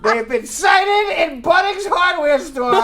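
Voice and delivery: laughing mock alert read; mock emergency alert. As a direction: announce it like a breaking alert. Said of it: "said one of these alerts".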